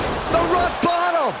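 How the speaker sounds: high, slow, drawn-out hype as the move lands; excited call. Real, not acted. Commentator calling the big move, excited and high. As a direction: sell the finisher to the crowd.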